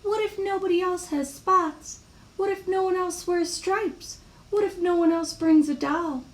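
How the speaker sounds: high, small fretful voice; childlike string of anxious questions; anxious worrying. Acted. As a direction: rattle off fears as a little worrier. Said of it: "had a long list of worries".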